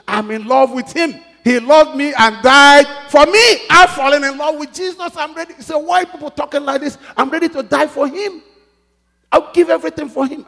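soar it to the back pew; preaching at full force. Acted. Preacher crying out his love for Jesus, at full force.

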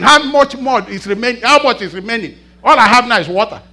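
loud, high comic pulpit telling; preaching at full force. Real, not acted. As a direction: play the scene big for the crowd.

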